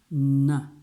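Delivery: a loud, held N; "N" pronounced by itself, loud and firm; isolated N sound. Shown as a demonstration. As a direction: sound out "N" forcefully on its own. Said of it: "It's a N".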